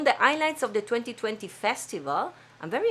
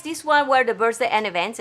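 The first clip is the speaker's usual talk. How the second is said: normal talking voice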